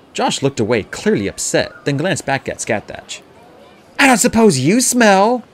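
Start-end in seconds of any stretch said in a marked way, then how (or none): 4.0-5.4 high, loud, drawn-out retort; stung comeback, pitched way up; snapped. A narrator's reading. Josh turning the smell remark on Scathach, snapping. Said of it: "he snapped".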